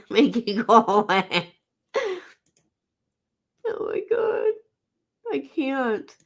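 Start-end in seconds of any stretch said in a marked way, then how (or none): 3.7-6.2 a high, teary wail of laughter; a real laughing cry. Real, not acted. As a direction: laugh until you cry. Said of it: "I got tears rolling down my face".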